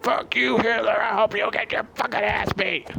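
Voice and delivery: high, yelled arena abuse; mock crowd yell. Wrestling fans cursing Hitler in the ring, high mock yell. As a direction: heckle him from the stands.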